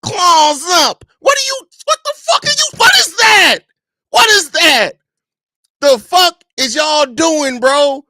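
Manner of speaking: high, loud, outraged shout; cartoonishly furious yelling voice; exasperated yell voice. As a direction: scream it like you can't believe what you're seeing. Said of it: "I read it all the time in that voice".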